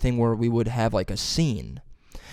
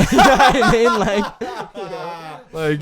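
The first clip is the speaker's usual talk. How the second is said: high, loud laughing; real laughter; 10 semitones higher, 8 dB louder, much slower than usual. Real, not acted. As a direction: laugh through the words.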